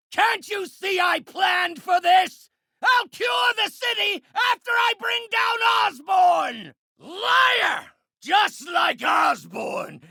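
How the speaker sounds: high, furious full-voiced shouting; enraged shout. Villain accuses foe of lies about Osborn, enraged shout. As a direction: roar it in outrage at your opponent.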